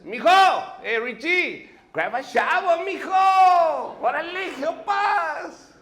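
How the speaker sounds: high, loud shout across the yard; Tio Chuy calling. Retold or imitated. Tio Chuy shouts at nephew to help, loud and high. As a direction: yell for him to grab a shovel. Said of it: "this is what Tio Chuy says".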